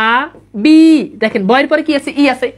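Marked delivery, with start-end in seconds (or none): 0.1-0.8 আ and বি said apart, each held; slow syllable-by-syllable demo of আ বি; sounded-out syllables. Shown as a demonstration. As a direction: say a long আ, pause, then a long বি. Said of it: "আমরা যদি উচ্চারণ করি".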